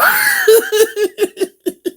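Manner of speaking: long high laugh; high-pitched laughing fit; real laughter. Real, not acted. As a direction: laugh helplessly. Oh my God.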